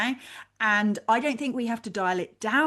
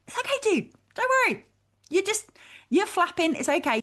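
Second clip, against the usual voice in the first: higher, soothing, like calming a friend; pragmatic reassurance; 11 semitones higher than usual. Acted. Talking to her inner critic, higher soothing reassurance. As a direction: settle the worried critic down kindly. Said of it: "pragmatic reassurance".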